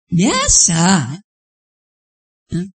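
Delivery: ordinary talk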